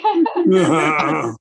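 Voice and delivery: a choked laugh that breaks toward tears; overwhelmed laugh catching in the throat; tearful laugh. Real, not acted. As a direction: laugh through sudden tears. Overcome accepting award.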